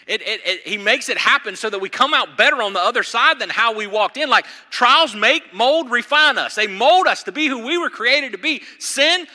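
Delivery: loud, high, driving sermon delivery; full-force preaching. Acted. Pastor to congregation on trials, full-force preaching.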